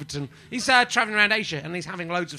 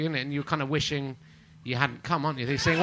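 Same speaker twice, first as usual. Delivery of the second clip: ordinary talk, nothing marked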